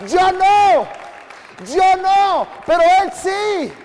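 full-voiced preaching shout. Real, not acted. Pastor shouting to congregation, not me but Him. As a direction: belt it out, forceful and sure.

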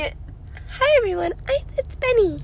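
very high, soft character voice; tiny high-pitched impression, softly put on; Bunny. Podcast host imitates an alter for the co-host, high soft impression. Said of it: "that was such a good impression".

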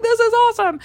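squealed high with delight; thrilled. Retold or imitated. While parasailing over the sea, high delighted squeal. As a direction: shout it from the sky, overjoyed. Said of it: "I was like".

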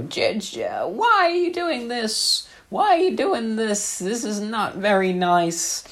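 put-on very high, slow, pleading kid voice; whiny little brother. Big brother imitating little Johnny's high, slow whine. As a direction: squeak it out like a scared little boy. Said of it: "he'd go".

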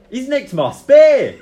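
loud, high, slow outraged shout; furious robbed fighter shouting. Acted. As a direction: yell it out, dragging it. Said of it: "looks down and he's like".

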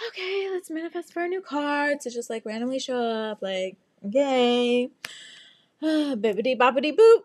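high, airy magic-spell chant; playful manifesting. Retold or imitated. Stranded driver conjuring a new car, playful. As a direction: cast a hopeful pretend spell, ending big.

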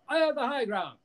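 high, hammed-up movie quote; Obi-Wan. Mock quote of the high ground line, high and theatrical. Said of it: "as Obi-Wan said".

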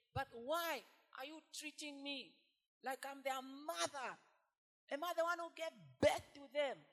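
loud, slow, high anguished plea; crying out to God. Acted. Moses crying out to God, loud anguished plea. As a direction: cry it out to God, pleading. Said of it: "Moses was crying out to God".

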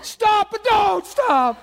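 high and loud, slow on don't stop; high loud plea, drawn out; overwhelmed plea again. Retold or imitated. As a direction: shout stop, stretch out but don't stop. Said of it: "one of them paradox places".

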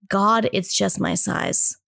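ordinary talk

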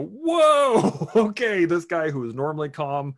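high, loud startled whoa breaking into a laugh. Retold or imitated. Everyone reacting when the calm guy snaps, startled and laughing. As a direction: throw it up high like you just got spooked. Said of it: "everybody would go".

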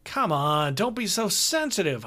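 brash, cheerful tour manager; hearty road manager. Acted. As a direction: play the chummy older road manager. Hogarth voice again.

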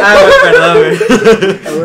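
very high, loud laughing yelp; real laughing shout. Real, not acted. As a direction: shriek an apology through laughter. Shouting apology amid laughter.

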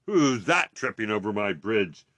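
loud, booming challenge; troll's roar. A narrator's reading. Troll challenging the second goat, roaring. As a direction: roar like a troll guarding his bridge. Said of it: "roared the troll".

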